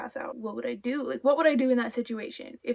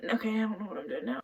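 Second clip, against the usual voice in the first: ordinary talk, nothing marked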